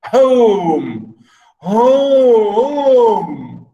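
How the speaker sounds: drawn-out bitter longing for home. Shown as a demonstration. Steward longing for home, said bitterly. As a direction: let the word ache, long and sore.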